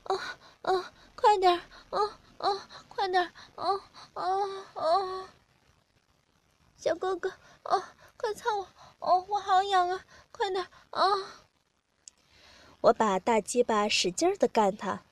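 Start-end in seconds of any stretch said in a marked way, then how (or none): 0.1-11.3 high, soft, whimpering voice; high pleading moans between words; acted moaning. A narrator's reading. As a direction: whimper and moan in a much higher voice. Narrator acts out partner's moaning.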